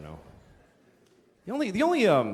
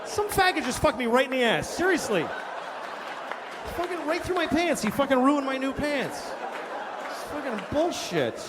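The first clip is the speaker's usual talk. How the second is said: indignant victim rant; 9 semitones higher than usual. Acted. ATM victim ranting, acted bit.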